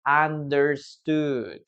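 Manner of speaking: Understood drawn out slowly, syllable by syllable; slow model word. Shown as a demonstration. Teacher slowly models Understood for students. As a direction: say Understood slowly so the class can copy. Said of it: "there it is, right?".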